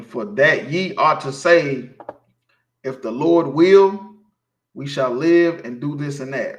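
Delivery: slow, loud solemn reading of the verse; slow, weighty scripture recital. Acted. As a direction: read it out like holy writ. Reads scripture aloud, slow and loud.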